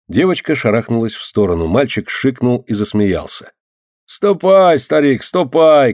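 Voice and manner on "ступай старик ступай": high, drawn-out shouted order; shouting. A narrator's reading. Yardkeeper to old man, shouting. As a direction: shoo him off in a raised voice. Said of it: "кричал дворник".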